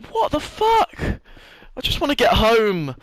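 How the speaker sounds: a high-pitched, disbelieving "what the fuck"; exasperated outburst. Retold or imitated. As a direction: squeal out your frustration at the stuck train. Fed up on a stalled train.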